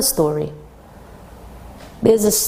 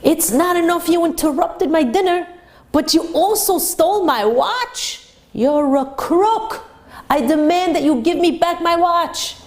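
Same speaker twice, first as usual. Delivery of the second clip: angry yell; 7 semitones higher than usual. Retold or imitated. Rich man chasing the rabbi over a missing watch, enraged. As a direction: hurl the accusation at the fleeing rabbi. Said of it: "he yells".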